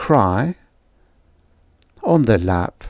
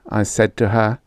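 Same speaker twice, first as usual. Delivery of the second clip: usual speaking voice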